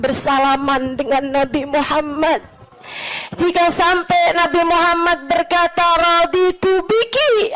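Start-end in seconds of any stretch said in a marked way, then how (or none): 5.9-7.5 high, slow reverent Arabic words; solemn quote. Retold or imitated. The Prophet telling a believer he is pleased, reverent. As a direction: say it like a blessing.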